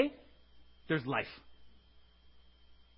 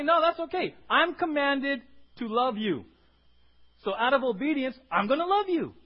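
bold confident reply; 5 semitones higher, 6 dB louder than usual. Acted. Pastor voicing a believer boldly answering rejection, confident. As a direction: declare it with resolve.

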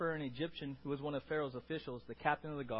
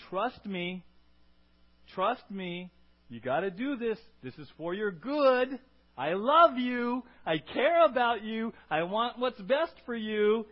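put-on rising, louder, slower loving plea to a child; pleading parent; 5 semitones higher, 8 dB louder, slower than usual. Parent pleading with a doubting child, swelling tender plea. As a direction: beg your kid to trust you, swelling with love.

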